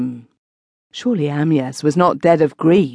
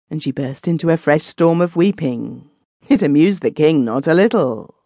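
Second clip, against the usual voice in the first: usual speaking voice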